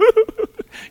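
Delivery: a high, loud laugh breaking into tears; choked-up laugh. Real, not acted. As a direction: laugh that cracks as the emotion hits. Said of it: "right to the end".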